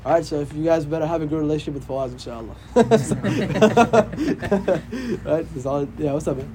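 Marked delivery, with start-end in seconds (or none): 2.6-5.2 long real laugh, higher pitched; hearty laugh. Real, not acted. Teacher joking with his class, laughing heartily. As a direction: burst out in a sustained chuckle.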